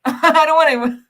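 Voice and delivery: giggling as she stops herself mid-roast; laughing through words. Real, not acted. Reactor laughing at her own roasting of the guy. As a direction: laugh it out, trying to stop.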